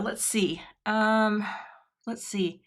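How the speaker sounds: ordinary delivery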